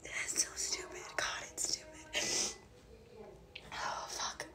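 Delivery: soft, broken whisper; tearful whisper. Real, not acted. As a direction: whisper it, spent from crying. Whispering after crying.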